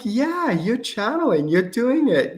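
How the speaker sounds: high, drawn-out, sing-song affirmation; excited encouragement. Retold or imitated. Teacher telling a student they're doing it, encouraging. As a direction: cheer the student on with slow, swooping pitch.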